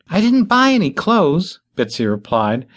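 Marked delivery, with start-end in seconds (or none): 0.1-1.6 put-on high, protesting young woman; pitched up in protest; defensive. A narrator's reading. Bitsy answers farmer.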